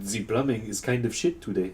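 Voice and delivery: a hammed-up French accent. Joking that a stroke made him French, in a French accent. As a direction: say it like a snooty Frenchman. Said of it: "Suddenly you're French".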